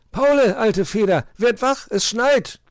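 loud, high-pitched shouting; excited wake-up call. A narrator's reading. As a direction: rouse a friend with urgent news. Willi wakes his friend.

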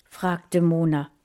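ordinary delivery

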